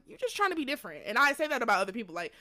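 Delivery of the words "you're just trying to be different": quick, much higher dismissive accusation; exasperated eye-roll in the voice; irritated jab. Retold or imitated. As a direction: scoff it out fast at a show-off. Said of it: "I get irritated 'cause I'm just like".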